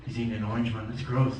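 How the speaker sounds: low scheming aside, mock schemer; plotting girl. Little blonde girl plotting with friends, low scheming voice. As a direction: plot it under your breath, pitched low. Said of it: "plotting. She's like".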